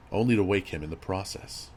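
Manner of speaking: usual speaking voice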